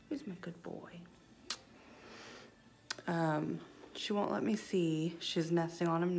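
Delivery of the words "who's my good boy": soft whispered praise; hushed pet praise. Real, not acted. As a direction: whisper sweetly to the bird.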